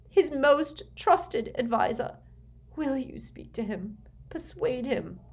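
high, desperate begging; nervous pleading. A narrator's reading. Desperate mother begging Snape to persuade the Dark Lord, pleading. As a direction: implore him, voice pitched well up. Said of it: "seemed to lose her nerve".

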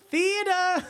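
high-pitched, drawn-out Southern call; hammed-up Southern twang, way up high; southern accent. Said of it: "with a southern accent".

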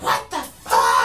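imitated holler that carries down a hallway; mock outraged yell. Teasing friend about being heard down the hall, mock outraged yell. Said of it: "I could hear you from all the way down the hall".

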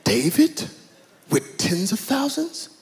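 slow, incredulous British voice, plunging on "but me"; hammed-up British outrage, questioning lilt; British accent. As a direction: play the affronted British king. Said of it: "he has a British accent".

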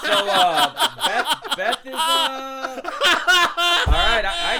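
loud laughter breaking through words; trying to talk through laughter; laughing. Real, not acted. Cracking up reading the line.

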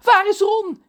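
high, loud call. A narrator's reading. Ron's mother searches for her son, calling out. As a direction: call urgently across the quay.

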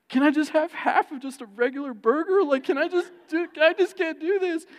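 high, hammed-up whine; whiny pleading. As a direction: beg your wife to let you cheat. Begging wife to quit the diet.

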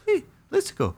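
put-on very high, small child voice; toddler's amazement. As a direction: imitate an awestruck little kid squeaking. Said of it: "stopped in his tracks and was like".

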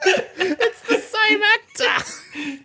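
high, slow words through laughter; giggling, drawn-out repetition; laughing reveal. Retold or imitated. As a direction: say it slowly while cracking up.

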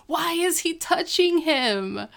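high-pitched, appalled disbelief; mock alarm. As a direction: squeal it in comic horror. Retold alarmed reaction, laughing before it.

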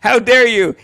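loud, high mock outrage; indignant. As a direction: play up the scandalized accusation. Retold indignant confrontation of man.

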